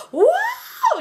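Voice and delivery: very high-pitched 'whoa'; hammed-up high shriek; mock shocked scream. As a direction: imitate a crowd shrieking in shock. Crowd shocked at the gun.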